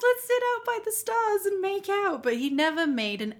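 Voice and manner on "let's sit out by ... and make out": high-pitched, giggly eager yes; swooning, keyed-up mock reply; gushing eagerness. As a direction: gush it high through a laugh. Acts her own eager reply.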